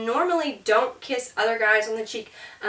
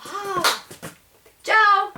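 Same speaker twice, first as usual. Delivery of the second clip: high, loud breezy ciao; cheery goodbye; 11 semitones higher, 5 dB louder than usual. Acted. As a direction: wave off a friend happily. Acting goodbye to friend.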